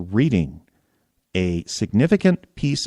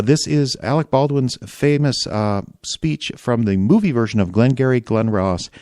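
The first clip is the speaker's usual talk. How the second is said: normal talking voice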